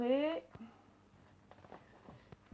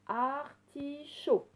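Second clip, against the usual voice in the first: "artichaut" slowly split as ar, ti, chaut; syllable by syllable; 3 semitones higher, much slower than usual. Shown as a demonstration. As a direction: separate the three syllables of "artichaut". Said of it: "qui correspond à la syllabe". (« artichaut » lentement, syllabe par syllabe)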